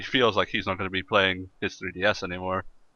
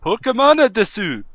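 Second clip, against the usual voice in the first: hammed-up anime-style Japanese, much higher and louder; mock Japanese; 12 semitones higher, 7 dB louder than usual. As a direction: say it like an over-the-top Japanese fan.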